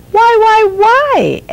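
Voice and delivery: high, eager repeated why; a small girl pestering for answers, pitched high; insistent child. A narrator's reading. Young Eldra pestering her family, high and eager. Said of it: "Eldra kept asking".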